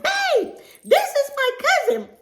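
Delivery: loud delighted announcement; excited shout. Retold or imitated. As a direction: call it across the shop. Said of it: "I get a little loud".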